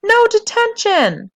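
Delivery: high, loud, drawn-out shout; girl shouting back at the sergeant. A narrator's reading. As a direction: yell it in defiance. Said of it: "Charlotte shouted".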